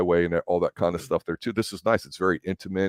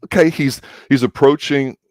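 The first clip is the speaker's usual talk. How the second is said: louder and much higher, eager; mock excitement; 7 semitones higher, 8 dB louder than usual. Viewer reacting to the Ferris wheel kiss, excited. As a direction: get worked up as the kiss nears. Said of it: "And you're like".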